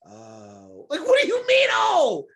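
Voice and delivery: high, loud mocking voice of a gullible listener; mock gullible host. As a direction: ham up the credulous podcaster. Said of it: "They sat here and were just like".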